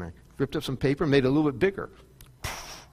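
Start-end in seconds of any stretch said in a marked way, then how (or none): 2.4-2.9 soft, voiceless puff of air; whispered burst of breath; imitated whoosh of flame. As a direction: blow a long airy poof like fire catching. Imitates bigger paper fire.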